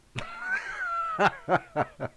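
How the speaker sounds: high-pitched chuckling burst; real laugh. Real, not acted. Streamer teasing Vic about accent, laughing. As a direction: laugh in a quick high run. Amused by listener's thick accent.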